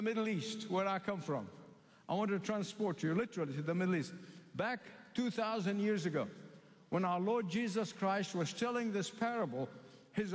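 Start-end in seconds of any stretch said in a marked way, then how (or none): none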